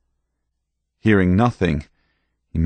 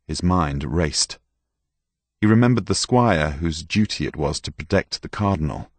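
plain reading voice

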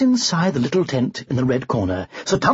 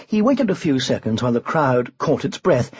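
ordinary delivery

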